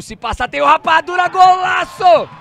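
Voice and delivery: screaming the goal call, high and loud; explosive goal commentary. Real, not acted. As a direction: erupt with joy, stretching the words. Goal scored, narrator erupts.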